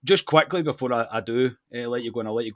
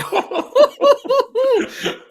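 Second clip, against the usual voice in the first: high loud disbelieving laugh; burst of laughter; 10 dB louder than usual. Real, not acted. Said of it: "is this a Ross joke here".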